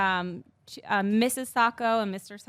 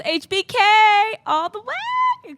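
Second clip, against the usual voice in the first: very high, loud, drawn-out cheer; slow, stretched squeal of support; fan cheer; 14 semitones higher, 10 dB louder than usual. Acted. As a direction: whoop it like a devoted fan. Cheering for her favourite wrestler.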